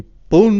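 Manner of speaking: high, loud imitated slap noise; loud slap sound. Retelling [name] slapping his arm, a loud imitated whack. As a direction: smack the sound out.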